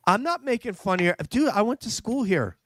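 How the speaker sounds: high-pitched, amused indignation; laughing protest. Real, not acted. Host accused of making fun of Boston accents, laughing protest.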